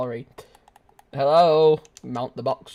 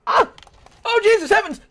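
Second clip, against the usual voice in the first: high loud startled yelp; startled cry; 10 dB louder than usual. Real, not acted. Streamer ambushed in game, high startled yelp. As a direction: jump out of your skin.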